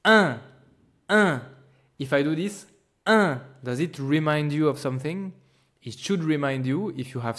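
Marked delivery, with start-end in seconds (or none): 0.0-1.4 high, loud un through a wide-open mouth; teacher showing the nasal un. Shown as a demonstration. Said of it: "opening it as wide as possible".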